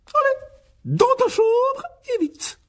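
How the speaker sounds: very high, loud bossy command; shrill aunt giving orders; ordering. A narrator's reading. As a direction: shoo him off sharply. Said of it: "ordonna-t-elle".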